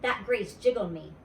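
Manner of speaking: ordinary delivery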